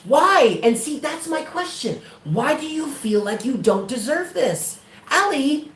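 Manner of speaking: pressing Allie on why she feels undeserving; high pleading why. Real, not acted.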